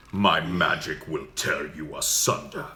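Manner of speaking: slow, dark archmage consumed by magic; deep archmage. Shown as a demonstration. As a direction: intone a menacing spell, low and drawn out. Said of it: "deep for that".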